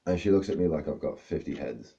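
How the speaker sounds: usual speaking voice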